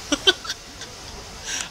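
squeaky high cracked laugh; voice-cracking laugh. Real, not acted. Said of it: "I just cracked my voice there".

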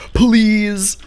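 high, drawn-out desperate begging; desperate plea. Real, not acted. Overwhelmed player begs too many enemies to leave, desperate.

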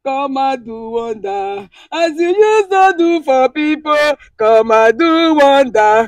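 pleading prayer chant, rising in force; fervent prayer. Real, not acted. Woman pleading with God in prayer. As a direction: pray it out, begging for power.

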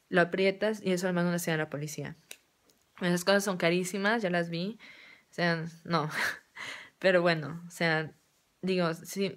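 ordinary talk

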